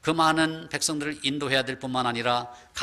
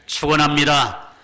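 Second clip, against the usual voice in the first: louder, emphatic proclamation; loud blessing call; 7 dB louder than usual. Real, not acted. Preacher calls out blessing. (힘차게 외치는 축원)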